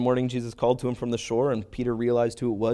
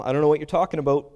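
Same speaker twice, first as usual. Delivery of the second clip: quick, raised-pitch denial, a bit louder; 4 semitones higher, 5 dB louder, faster than usual. Retold or imitated. Peter denying Jesus before them all, quick denial. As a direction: brush it off fast with mild force. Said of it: "he denied it before them all".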